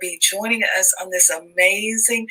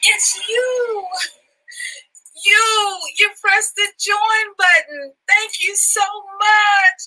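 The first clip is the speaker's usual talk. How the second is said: very high, gushing hostess voice; high-pitched, overjoyed welcome at the door; 7 semitones higher than usual. Acted. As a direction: greet them like dear guests, all delight. Greeting VIP guests at door.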